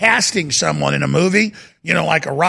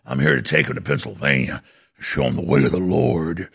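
much lower, put-on rural hillbilly voice. Host imitating a man taking kids to Pennsylvania, low put-on hillbilly voice. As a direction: sink way down and play the Pennsylvania hillbilly. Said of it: "I'm not trying to talk like a hillbilly".

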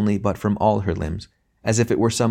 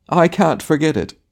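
high, loud tragic wail; tragic cry; 8 semitones higher, 5 dB louder than usual. Acted. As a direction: cry it out like a ham actor. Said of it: "cried the tragedian".

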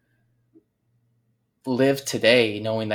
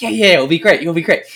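much higher and louder, gleeful; gleeful insisting; 7 semitones higher, 10 dB louder than usual. Retold or imitated. Friend assuring doubtful Zach, high and gleeful. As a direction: beam and insist it'll work.

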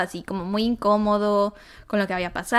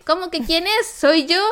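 incredulous; 7 semitones higher, 3 dB louder than usual. Retold or imitated. Daughter indignant on phone with mom.

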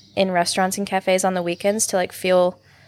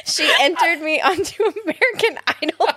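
laughing through it, pitched up; 9 semitones higher than usual. Real, not acted. Telling her friend about her mom, laughing. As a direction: laugh as you tell it.

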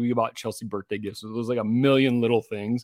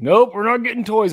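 loud, higher-pitched firm no; retold firm refusal; 9 semitones higher, 8 dB louder than usual. Dad to tantruming daughter in Target, loud firm refusal. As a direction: act out a dad refusing outright. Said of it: "and I'm like".

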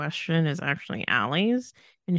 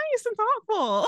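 sarcastic; 12 semitones higher than usual. Real, not acted. Sarcastic praise of Carrie Ann.

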